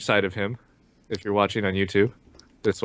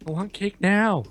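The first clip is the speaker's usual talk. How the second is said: put-on whiny child voice; 9 semitones higher than usual.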